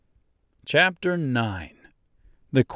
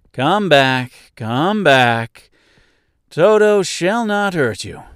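mild, louder call; 5 semitones higher, 5 dB louder, slower than usual. A narrator's reading. Woodman calls to the scampering mice, calling out reassuringly. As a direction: call them back with some reassurance. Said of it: "he called to the mice".

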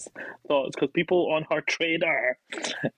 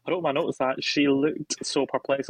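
ordinary talk, nothing marked

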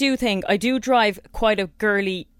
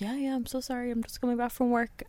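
meek sorry; 3 semitones higher, 10 dB quieter than usual. Retold or imitated. Meek apology to guard.

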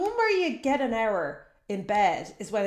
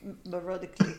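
put-on South African accent; 2 semitones lower, 6 dB quieter than usual. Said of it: "Can we say that in South African".

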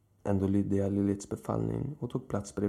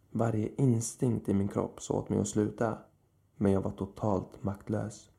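ordinary delivery

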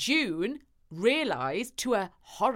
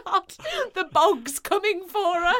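high, laughing in mock horror; 8 semitones higher than usual. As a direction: laugh through it. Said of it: "I was like".

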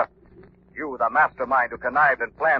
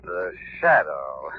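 low, slow menacing announcement; ominous slow voice; 12 semitones lower, 6 dB quieter, much slower than usual. Acted. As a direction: let the name hang darkly. Mysterious hero announces himself.

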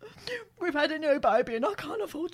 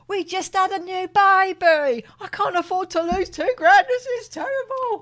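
mock distress; 4 semitones higher, 6 dB louder than usual. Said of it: "You think I'm acting now?".

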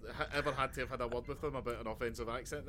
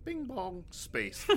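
mock intercom chime; 6 semitones higher, much slower than usual. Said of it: "all of a sudden".

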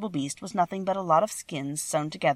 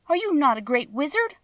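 high, disbelieving question; astonished cry; 9 semitones higher than usual. A narrator's reading. Dorothy learning the wizard is make-believe, crying out in surprise. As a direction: ask in a raised pitch, taken aback. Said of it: "cried Dorothy".

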